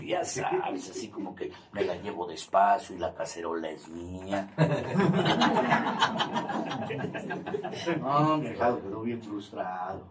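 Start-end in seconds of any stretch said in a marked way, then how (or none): 4.5-7.9 long breathy laugh. Real, not acted. Laughs at his own story.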